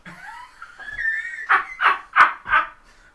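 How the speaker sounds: very high, loud cackle; high goblin cackle. Acted. DM laughs in voice.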